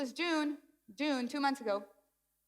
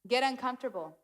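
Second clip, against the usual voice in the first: normal talking voice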